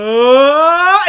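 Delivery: long, loud rising "aaah" scream; imitated scream. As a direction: demonstrate a drawn-out crowd-starting yell. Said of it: "and just scream".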